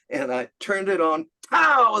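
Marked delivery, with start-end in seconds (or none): 1.5-2.0 high, loud burst; punchy exploding sound effect; explosion imitation. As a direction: boom it out like a sudden blast.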